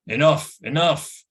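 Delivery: loud, rising call to stop; commanding shout. Acted. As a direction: call out to halt the fight. Gwydion stops Taran shaking Gurgi.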